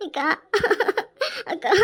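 wailing sobs after calling out; crying. Acted. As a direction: call for an older sister, breaking into sobs. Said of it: "அம்மா, அழாத". (விம்மி அழுதபடி)

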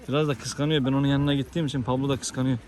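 ordinary talk, nothing marked

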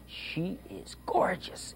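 hushed, drawn-out whisper. Shown as a demonstration. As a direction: whisper it like a secret. Teacher whispers new word dramatically.

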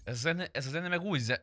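ordinary delivery

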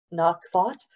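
ordinary delivery

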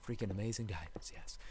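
excited whisper. Real, not acted. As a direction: hush it like a secret thrill. Gloating over diamonds mid-play.

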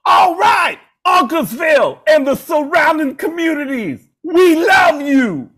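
high, loud rock-star farewell yell. Retold or imitated. Frontman saying goodnight to the arena, loud and hammed-up. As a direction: stretch the last words out to the whole arena. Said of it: "at the very end of the show".

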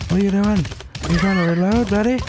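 high, loud taunting yell; mocking shout. Real, not acted. Gamer yelling taunts at an enemy caught reloading. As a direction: taunt the enemy at full volume.

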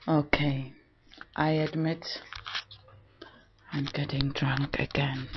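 very soft, low admission; hushed confession. Real, not acted. Vlogger admitting to drinking, hushed and low. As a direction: drop right down, almost to a whisper.

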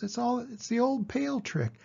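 high, fussy goose voice; a know-it-all goose warning; the goose warning Wilbur against the pail trick, high. A narrator's reading. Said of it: "said the goose".

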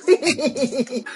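giggle. Acted. Giggle after the emoji tag.

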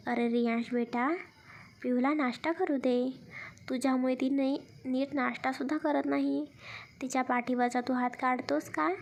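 ordinary delivery

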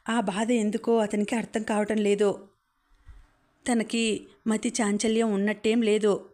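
usual speaking voice

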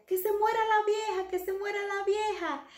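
high parrot voice chanting; squawking parrot. Acted. The parrot taunting the passing old lady, high squawk. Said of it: "el loro siempre decía".

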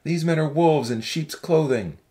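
low-pitched, forceful character harangue; restrained shout of an accusing young man; shouted warning. A narrator's reading. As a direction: call out a warning without much volume. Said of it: "a young man stood up and shouted".

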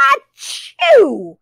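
high loud achoo; duck sneeze. Acted. Reading aloud, the duck sneezes.

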